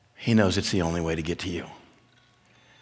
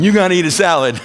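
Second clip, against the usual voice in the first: high, loud teasing jeer; mocking friend; 9 semitones higher, 8 dB louder than usual. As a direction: make fun of him in a raised voice. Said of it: "one of his friends makes fun of him".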